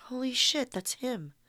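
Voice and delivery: ordinary delivery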